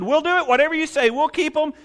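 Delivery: high, eager voice of the people; eager promise. Retold or imitated. The people promising God, high and eager. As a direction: pitch it up like eager people vowing.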